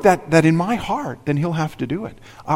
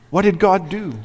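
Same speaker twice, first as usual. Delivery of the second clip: ordinary talk, nothing marked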